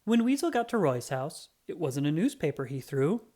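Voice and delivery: usual speaking voice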